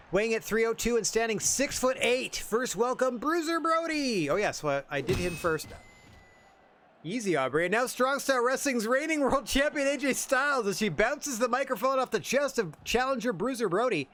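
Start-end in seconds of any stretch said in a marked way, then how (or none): 0.1-4.3 ring announcer intro. Acted. Introduces the challenger. 7.6-14.1 big booming announcer intro, pitched up; ring announcer. Acted. Host opening the championship match, booming announcer voice.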